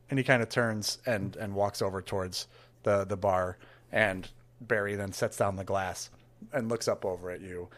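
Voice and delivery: ordinary talk, nothing marked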